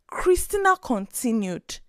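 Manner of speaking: plain reading voice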